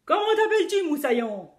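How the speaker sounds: put-on a loud, high pirate chief asking the boy's name; lightly voiced pirate chief questioning the cabin boy. A narrator's reading. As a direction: demand it like a booming captain. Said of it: "m'a demandé le chef des pirates".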